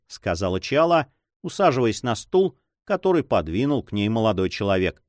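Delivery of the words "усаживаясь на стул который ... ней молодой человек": usual speaking voice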